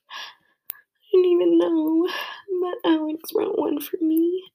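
tearful high whisper, breaking; crying whisper. Real, not acted. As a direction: whisper it through tears. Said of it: "stopped crying".

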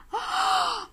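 very high, breathy "ah"; high gasp. A narrator's reading. Girl exclaiming before the mirror, high gasp. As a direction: let out a sudden high gasp.

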